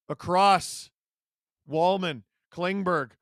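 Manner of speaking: ordinary talk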